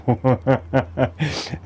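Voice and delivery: short run of even, bubbling chuckles; light chuckle. Real, not acted. Teacher delighting in the wonderful channeled energy, light chuckle. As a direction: chuckle lightly in a quick string.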